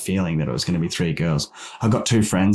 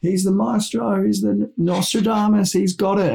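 high, excited hype of the uncle's gift; family chirping in; 11 semitones higher than usual. Retold or imitated. Relatives at the barbecue cheering on the uncle, high and excited. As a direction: hype him up like an oracle, pitched way up. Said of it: "everyone's chirping in".

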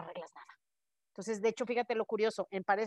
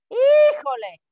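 exasperated híjole; 13 semitones higher, 11 dB louder, much slower than usual. Real, not acted. Exasperated exclamation about defensiveness.

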